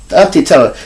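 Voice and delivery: ordinary talk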